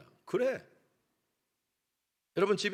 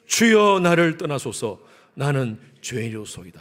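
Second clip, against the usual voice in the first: loud scripture cry; 3 semitones lower, 7 dB louder, slower than usual. Acted. Reading Peter's confession aloud.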